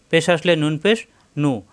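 ordinary delivery

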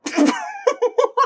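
high breathless laugh after the scare; shocked laugh. Real, not acted. Player laughing in shock after the jump scare. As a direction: laugh off the fright.

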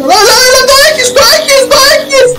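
real excited high-pitched yelling; frantic cheering. Real, not acted. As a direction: cheer the car over the line. Final push, yelling encouragement.

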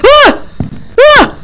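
high terrified scream; startled scream. Real, not acted. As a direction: scream in sudden fright. Ghost appears, screaming.

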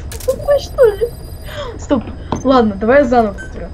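ordinary delivery

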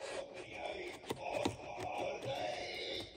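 slow, shouted troll threat. Acted. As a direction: roar it slowly like a troll. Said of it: "sa trollet".

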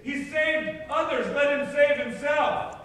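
slow, loud, high-pitched jeer; crying out. Acted. High priest mocking Jesus on the cross, crying out. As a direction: cry it out forcefully, word by word. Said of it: "the high priest cried out".

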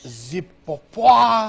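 mock French.

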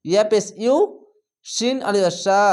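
normal talking voice

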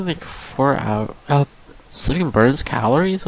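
usual speaking voice

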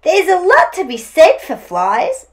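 high, loud keen agreement; animated story-time dingo; agreeable Dingo. A narrator's reading. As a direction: take up the idea eagerly. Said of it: "agreed Dingo".

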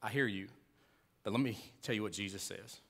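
soft and low, calmly; a quiet reassuring answer; calm gentle reply. Shown as a demonstration. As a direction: answer gently, without a fight. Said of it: "But rather say".

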